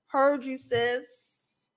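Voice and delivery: normal talking voice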